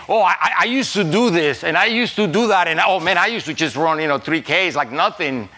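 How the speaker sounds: high, loud mock boasting about former glory; nostalgic boasting. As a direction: exclaim it like someone bragging about the old days. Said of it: "when we say".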